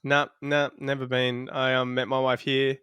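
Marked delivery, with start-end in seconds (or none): none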